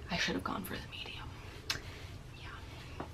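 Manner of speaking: soft regretful whisper to herself; hushed self-reproach; whispered aside. Real, not acted. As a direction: breathe it out as a private regret.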